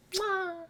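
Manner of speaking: tiny high-pitched noise; very high, soft mimicked squeak; imitated squeal. Imitating the character's closing sound.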